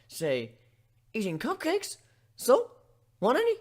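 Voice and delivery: high, offhand, then a loud squeaky offer; breezy kid dragon teasing; cheeky baby dragon. Acted. Spike teasing the blushing ponies, high and nonchalant.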